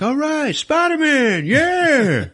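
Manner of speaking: high, loud whooping onlooker; excited cheering. Retold or imitated. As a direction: cheer wildly at a passing hero, very high and loud. Onlooker cheering as Spider-Man swings by.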